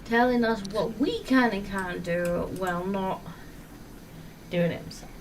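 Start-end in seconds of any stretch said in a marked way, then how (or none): none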